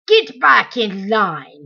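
loud, slow barked order; shouted. A narrator's reading. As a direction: shout the prisoner back into line. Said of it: "he shouted".

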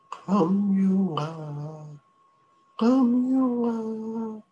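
very high, slow eerie call; monster voice. Acted.

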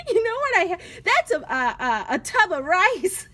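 giggling confession about the rice tub; loud amused admission; laughing out loud. Real, not acted. Laughing about her phone stand.

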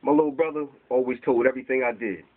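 ordinary talk

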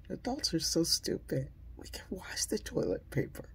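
put-on hushed, scheming little kid; conspiratorial kid whisper. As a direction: whisper it like a secret plan. Kids plotting, recalled childhood thought.

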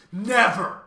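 high loud final no; emphatic never. A narrator's reading. Math magician answers Milo, loud emphatic never. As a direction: slam the word down. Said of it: "he repeated".

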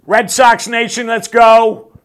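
loud, high call to the fans; rally the crowd at full volume; big cheering call-out; rallying shout. Acted.

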